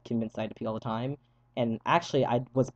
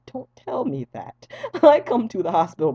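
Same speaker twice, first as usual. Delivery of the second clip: whiny protest; 8 semitones higher, 7 dB louder than usual. Retold or imitated. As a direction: drag it out like a sulking kid. Whining at nurse's remark.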